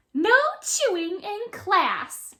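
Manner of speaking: high, loud sweetly menacing teacher; smiling. A narrator's reading. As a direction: smile through the threat. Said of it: "she smiles".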